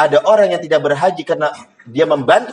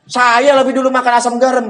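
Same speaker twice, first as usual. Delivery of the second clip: stubborn father; 7 semitones higher, 3 dB louder than usual. Retold or imitated. Father refusing child's advice.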